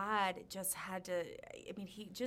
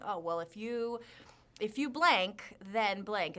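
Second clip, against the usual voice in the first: mocking formula; 2 semitones higher, 6 dB louder than usual. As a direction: scoff out the pat formula. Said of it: "just saying".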